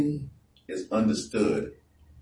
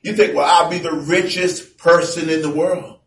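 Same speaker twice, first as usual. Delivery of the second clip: preaching at full force; 11 semitones higher, 8 dB louder than usual. Acted. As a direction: boom the verses out to the congregation. Preaching about riches.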